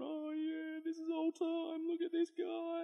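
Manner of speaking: put-on high tearful coo over a baby. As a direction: coo at the newborn through happy tears. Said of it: "she's crying, going".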